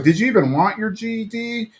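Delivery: mock wounded reproach; upset. [name] questioning Tammy on the drive, mildly upset. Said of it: "[name]'s upset".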